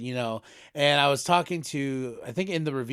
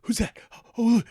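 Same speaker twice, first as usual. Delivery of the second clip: rising, higher-pitched guesses tumbling out; imitating excited kids; 6 semitones higher, 4 dB louder than usual. Telling how kids reacted in the theater, mock excited wonder. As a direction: mimic kids' eager questions, pitch climbing. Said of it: "All the kids, they were just like".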